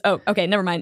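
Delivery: ordinary delivery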